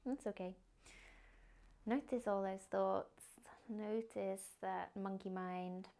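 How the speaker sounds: soft, unhurried meditation guidance; guided meditation. Acted. Yoga teacher guiding the class into a body scan, soft meditation voice. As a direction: ease them into stillness gently.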